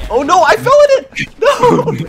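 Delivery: high, loud laughing cry; laughing shout. Real, not acted. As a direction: yell in mock despair while laughing. Falls into the hole, laughing.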